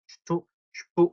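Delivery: "슈투" and "슈프" opening with sh before t and p; teacher's demonstration of "슈투", "슈프"; sh sound demo. Shown as a demonstration. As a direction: sound out "슈투" as sht and "슈프" as shp. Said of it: "하고 소리가 난다는 거".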